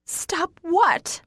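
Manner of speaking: high-pitched screamed demand. A narrator's reading. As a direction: scream the question at him. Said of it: "I scream".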